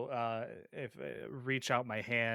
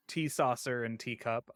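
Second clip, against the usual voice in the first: usual speaking voice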